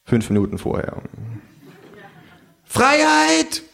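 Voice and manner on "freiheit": much higher, drawn-out kangaroo call; proclaiming kangaroo. Acted. Kangaroo on the bar counter proclaiming freedom. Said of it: "ruft das Känguru".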